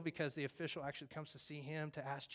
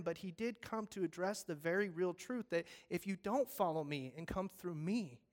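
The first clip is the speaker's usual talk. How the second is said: usual speaking voice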